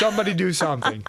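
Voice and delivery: quick exasperated plea, mock; fed-up plea. Guest mimicking a fed-up onlooker at a noisy gathering, quick mock plea. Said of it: "sitting in that corner going".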